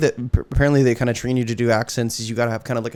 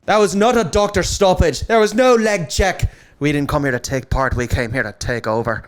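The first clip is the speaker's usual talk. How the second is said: light Irish accent, brash fighter talk; a hint of Irish trash talk at a press conference; showing off his Irish accent, punchy and cocky; Conor McGregor impression; 3 semitones higher than usual. Shown as a demonstration. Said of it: "Conor McGregor impersonations".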